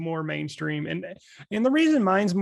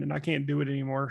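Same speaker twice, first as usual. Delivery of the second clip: usual speaking voice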